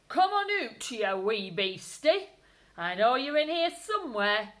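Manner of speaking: put-on loud, growling old gardener; gruff gardener. Gardener hunting the rabbit in the shed, loud and gruff. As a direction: call him out of hiding, menacing. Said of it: "muttered Mister McGregor".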